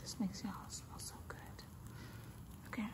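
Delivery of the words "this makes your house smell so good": whispering. Real, not acted.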